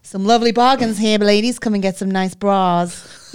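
hammed-up showbiz stallholder; a scouse stallholder's mock showbiz voice. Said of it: "puts on this, like, showbiz voice".

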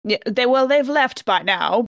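ordinary talk

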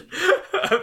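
loud laugh. Real, not acted. Laughing at the Woody's Roundup joke.

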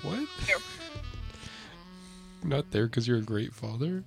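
ordinary talk

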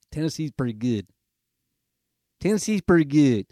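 put-on playful stab at a Tennessee accent. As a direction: lightly mimic a Tennessee accent. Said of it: "why I'm doing that accent".

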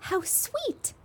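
high, soft cooing "How sweet". Acted. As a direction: coo it like over a baby animal. Crowd coos at the bears.

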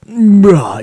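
exasperated bruh. Retold or imitated. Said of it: "so you're like".

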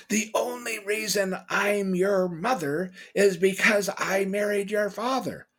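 high, loud retold tirade, drawn out; angry stepmother. Stepmother rejects the boy after beating him, high drawn-out angry yell. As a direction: imitate a screaming stepmother, stretching the words. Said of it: "But this is what she said".

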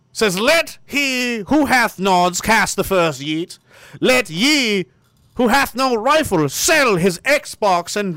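high, slow solemn pulpit reading; mock scripture reading. Streamer solemnly reading fake scripture to chat. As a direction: intone it like holy writ. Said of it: "Why'd your voice change so much".

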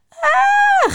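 very high, loud startled shriek; piercing yelp; shrieking scream. A narrator's reading. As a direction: scream in sudden fright. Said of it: "gil ik".

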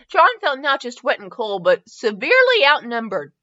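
plain reading voice

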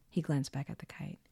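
ordinary delivery

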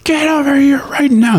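very high, loud mother's yell; berserk mom yell. Retold or imitated. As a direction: snap at the kid in the street. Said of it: "moms go berserk".

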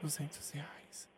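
barely audible prank whisper. Pretend. Prank caller to the seller, a near-silent whisper. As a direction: whisper it so he can't hear. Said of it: "prank whisper".

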